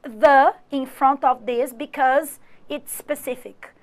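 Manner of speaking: slow English reading. Shown as a demonstration. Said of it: "you can put".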